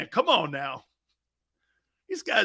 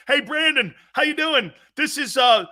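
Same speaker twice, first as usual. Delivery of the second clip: higher, louder mock caller voice; a lightly voiced prank caller; South Philly caller bit; 7 semitones higher, 4 dB louder than usual. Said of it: "Dude, the bit sucked".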